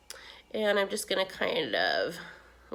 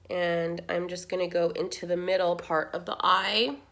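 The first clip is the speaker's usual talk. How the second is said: ordinary delivery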